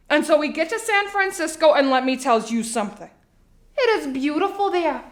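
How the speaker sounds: chatty Long Island mother telling all; Sheila, Long Island housewife. Acted. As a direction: play a confiding, theatrical suburban mom. Plays monologue housewife Sheila.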